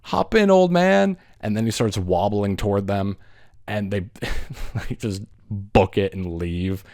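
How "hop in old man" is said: mock teen voice, much higher and louder; cocky teenagers. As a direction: call it out like a careless teen in a car. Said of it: "and they're like".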